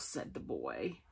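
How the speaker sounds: usual speaking voice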